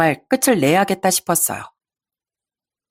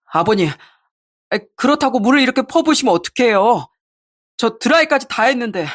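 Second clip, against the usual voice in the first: lightly voiced put-out husband, rising higher; whining husband; 5 semitones higher than usual. Husband to father-in-law about ruined blow-dry, whining. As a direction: grumble as the doused husband.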